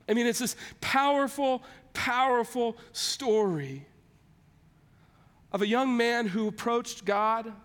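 normal talking voice

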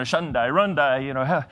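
spoof praying in tongues with a grin; mock tongues prayer. Pastor to church, joking about tongues, mock fervent babble. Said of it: "just praying in the spirit".

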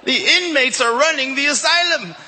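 high, booming comic shout; hammed-up, high-pitched holler; incredulous outburst. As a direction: call it out in mock disbelief. Comic incredulity in retold story.

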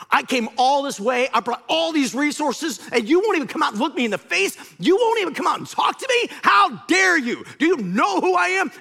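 acted indignant shouting, high and loud; livid. Naaman raging at the prophet who won't come out, livid. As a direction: rage like an insulted commander, building to a shout. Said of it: "And Naaman's livid".

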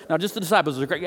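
normal talking voice

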